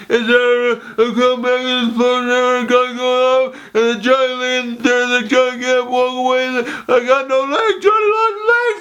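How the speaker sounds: hysterical, garbled high wailing; Rambo. Retold or imitated. Rambo breaking down at the end, incoherent wailing. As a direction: blubber unintelligibly in an overwrought breakdown. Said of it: "breaks down and gets emotional slash hilarious".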